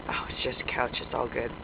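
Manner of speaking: low, soft, settling down; hushed relief. Pretend. Player calming down after a false alarm, low hushed relief. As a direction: drop right down to a soft self-reassurance.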